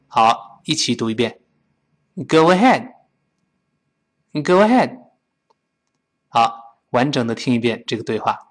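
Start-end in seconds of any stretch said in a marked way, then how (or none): 2.2-5.0 quick linked "Go ahead", d held without a burst; tongue stops the end of "Go ahead"; unreleased final d. Shown as a demonstration. Teacher reads together with students, "Go ahead" with d unreleased. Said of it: "那个的没有爆破出来".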